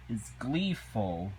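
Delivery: usual speaking voice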